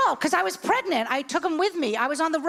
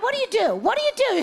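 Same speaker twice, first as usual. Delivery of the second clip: normal talking voice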